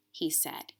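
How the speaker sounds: put-on reading a children's book aloud, gently expressive; story narration. A narrator's reading. Teacher reads the Velveteen Rabbit to her class, storybook narration. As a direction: read it like a bedtime story.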